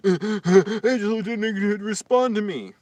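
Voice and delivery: high, slow, dramatic gasp and whine; mock gasp. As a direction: parody a needy viewer gasping. Mocking a compulsive chat viewer.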